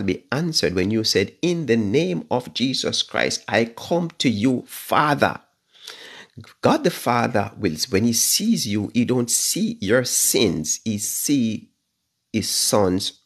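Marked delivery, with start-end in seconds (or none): none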